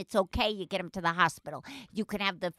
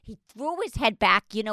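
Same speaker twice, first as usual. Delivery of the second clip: normal talking voice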